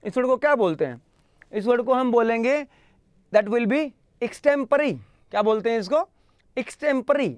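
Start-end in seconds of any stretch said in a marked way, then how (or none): none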